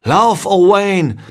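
high, slow, loud urgent call to flee; father's urgent cry. A narrator's reading. Father warns his son during the fire, calling out urgently. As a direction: cry out to the boy to run. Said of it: "rief mein Vater".

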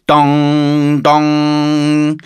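high, loud sustained "dong"; imitated bell toll. A narrator's reading. Girl pulls the bell rope, voiced bell tolling. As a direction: ring it out on one held note. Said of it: "Sie zog am Glockenseil".

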